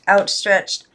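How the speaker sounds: ordinary delivery, nothing marked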